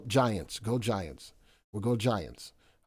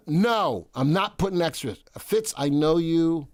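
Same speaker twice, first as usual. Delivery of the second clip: usual speaking voice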